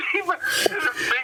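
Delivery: laughing so hard the voice squeaks; words squeezed out through laughter; real high laugh. Real, not acted. Laughing at own punchline.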